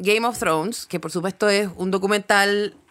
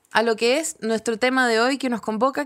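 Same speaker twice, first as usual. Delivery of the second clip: ordinary talk, nothing marked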